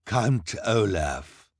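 villain announcing himself with pride; said proudly. A narrator's reading. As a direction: savor your own name. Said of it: "Count Olaf said proudly".

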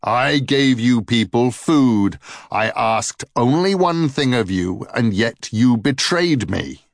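slow, booming and loud; voice like thunder. A narrator's reading. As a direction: boom out the betrayal. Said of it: "in a voice like thunder".

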